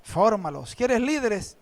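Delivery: lightly voiced Lord, low and soft. Lord answering the crying pastor, low soft command. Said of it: "el Señor me dijo".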